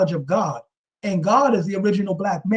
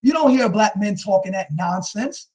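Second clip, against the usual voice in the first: normal talking voice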